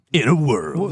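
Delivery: imitated trailer voiceover; mock-dramatic film trailer announcer; movie trailer guy. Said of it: "the movie trailer guy".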